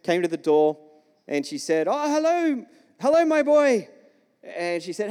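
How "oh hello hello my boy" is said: high-pitched German lady's greeting; German-accented lady. Retold or imitated. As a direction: imitate a German neighbour, pitched well up. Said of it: "she had German accent".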